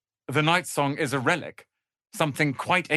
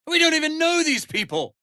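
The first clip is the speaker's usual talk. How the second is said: exasperated; 12 semitones higher, 4 dB louder than usual. Acted. Protesting helping strangers.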